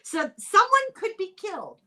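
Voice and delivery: high, loud alarmed announcement of danger; dramatic alarm. Retold or imitated. Host quoting Scottish Parliament about seagulls, hammed-up alarm. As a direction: read the warning like a panicked official. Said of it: "That's a quote from Parliament".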